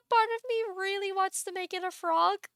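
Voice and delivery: high giggly voice, amused at the idea; tickled, high-pitched musing. Real, not acted. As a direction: say it high, barely over the laughter. Giggling over frog idea.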